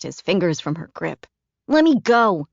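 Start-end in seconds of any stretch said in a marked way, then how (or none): 1.7-2.5 put-on loud, high boy's protest; struggling child. A narrator's reading. Flick fighting Caroline's grip, loud protest. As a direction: wrench free and shout it.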